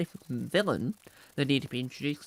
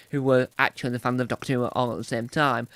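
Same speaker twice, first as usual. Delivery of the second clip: ordinary talk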